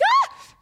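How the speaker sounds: high, loud startled scream; sudden high scream. Retold or imitated. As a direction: shriek in surprise as you jump. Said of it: "And I scream really loud".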